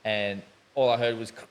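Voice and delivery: ordinary delivery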